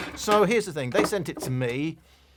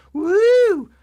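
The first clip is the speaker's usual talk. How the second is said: high, loud "woo"; big high cheer; mock excited whoop; 10 dB louder than usual. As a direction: let out a showy, drawn-out whoop. Mock excitement over product's flashy name.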